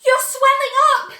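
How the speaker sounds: high, very loud shriek; scream. A narrator's reading. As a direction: shriek in horror at the daughter. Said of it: "screamed Missus Beauregard".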